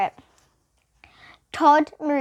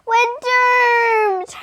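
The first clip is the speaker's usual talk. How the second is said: much higher, louder despairing wail; 12 semitones higher, 8 dB louder than usual. A narrator's reading. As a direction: cry it out in despair. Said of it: "Mac wailed".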